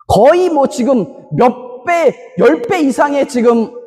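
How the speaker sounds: normal talking voice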